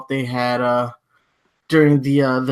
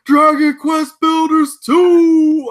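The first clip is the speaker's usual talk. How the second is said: hammed-up announcer bellow, much higher and louder; over-the-top trailer narrator; 13 semitones higher, 6 dB louder, much slower than usual. Imitating the game trailer's narrator at its very end, over-the-top shout. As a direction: stretch each word out into a big mock-hype climax. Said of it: "he just kept getting louder and louder".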